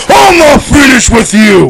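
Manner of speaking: drawn-out, high shouted threat; loud mock shout. Wrestler yelling after date leaving for the car, mock shout. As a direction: bellow it slowly, playing the angry wrestler.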